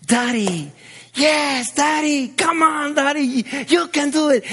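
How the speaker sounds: daughter's cheering voice. Retold or imitated. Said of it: "when I heard that voice".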